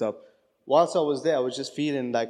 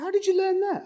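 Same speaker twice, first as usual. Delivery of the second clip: amazed high squeal. Retold or imitated. Friend astonished he knows the phrase, squealing high in surprise. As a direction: shoot up very high in delighted disbelief.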